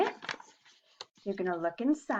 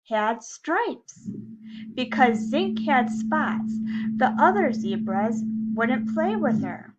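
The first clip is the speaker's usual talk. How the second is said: lively, louder storybook reading; reading to children; 2 semitones higher, 6 dB louder, slower than usual. A narrator's reading. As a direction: read it like story time for little kids. Reading a picture book to class.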